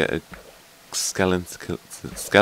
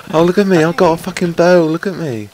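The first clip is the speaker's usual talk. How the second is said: high, loud taunting mimicry; high mocking imitation of the skeleton; 11 semitones higher, 11 dB louder than usual. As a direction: sneer at the skeleton's bragging.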